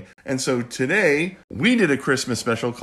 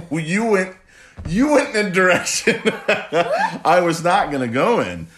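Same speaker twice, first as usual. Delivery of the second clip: laughing; 7 semitones higher, 6 dB louder than usual. Real, not acted. Dad laughing at daughter's joke.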